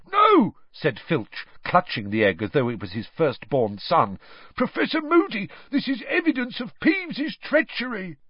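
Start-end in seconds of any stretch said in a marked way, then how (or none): 0.1-0.6 much higher, loud squawk of a refusal; Filch refuses. A narrator's reading. Filch clutching the egg from Moody, high loud squawk. 4.6-8.2 much higher wheedling caretaker voice; Filch pleading. A narrator's reading. Filch protesting to Moody, high wheedling voice.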